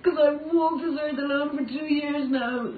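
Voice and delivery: crying through the words. Real, not acted. Breaking down over her loneliness.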